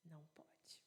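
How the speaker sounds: hushed, voiceless whisper. Real, not acted. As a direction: barely breathe the words out. Deadpan joke after a long pause.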